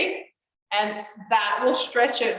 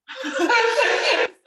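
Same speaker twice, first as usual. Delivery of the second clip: bright burst of laughter at herself; real laugh; 13 semitones higher, 6 dB louder than usual. Real, not acted. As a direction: laugh it off, amused at yourself. Laughs at own body failing.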